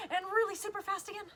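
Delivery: high, quick and excited; playful speeding up. Pretend. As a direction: rush it brightly for the kids. Voice mimics fast flapping.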